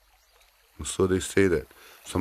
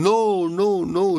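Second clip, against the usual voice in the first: high, loud repeated no; aunt cries out over the bag, high and loud; alarmed protest; 13 semitones higher, 6 dB louder, much slower than usual. Acted. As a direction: cut it off in alarm.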